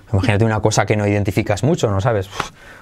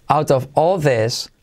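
slow model phrase; slower than usual. Shown as a demonstration. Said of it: "se convierte de como resuelve".

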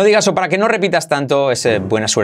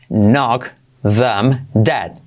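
very slow knock them dead; slow explained; much slower than usual. Shown as a demonstration. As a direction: space every word out. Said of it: "primero explicado lento". (despacio, palabra por palabra)

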